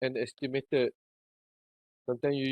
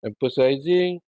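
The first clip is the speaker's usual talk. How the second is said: repeated clearly; 8 dB louder than usual. Shown as a demonstration. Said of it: "Boleh ulang sikit balik dekat emphasizing tadi".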